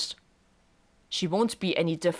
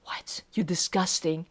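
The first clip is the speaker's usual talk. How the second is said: ordinary delivery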